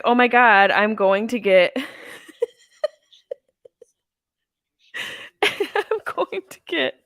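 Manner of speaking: high, slowing, laughing squeal; excited squeal. Retold or imitated. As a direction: squeal with giddy delight. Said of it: "I was like".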